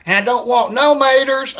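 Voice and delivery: mock hillbilly ordering through the speaker. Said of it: "redneck guy".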